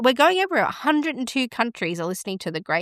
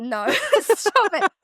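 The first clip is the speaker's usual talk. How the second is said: high, giggly "stop it"; amused, drawn-out squeal with a laugh; laughing protest; 10 semitones higher, slower than usual. Real, not acted. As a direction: brush off the joke through laughter. Laughing at co-host's joke guess.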